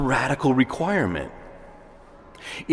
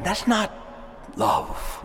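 ordinary talk, nothing marked

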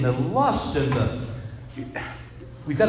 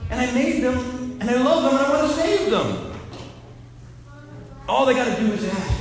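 higher, tender voice of God; lightly voiced loving Lord; 9 semitones higher than usual. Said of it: "he says to Faustina".

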